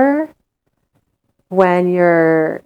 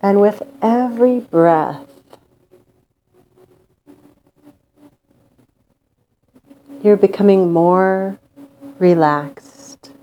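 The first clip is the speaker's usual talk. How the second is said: guided meditation. Acted. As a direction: lull them into calm with long pauses.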